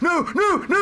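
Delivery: high frightened cries of no; panicked no. Retold or imitated. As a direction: wail no as the scare hits. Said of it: "they were just like".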